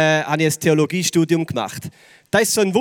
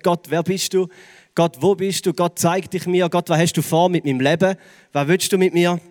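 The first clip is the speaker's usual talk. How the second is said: normal talking voice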